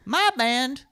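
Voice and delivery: high, loud complaint; imitating an indignant local musician; aggrieved heckler. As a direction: holler the grievance at the stage. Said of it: "And he goes".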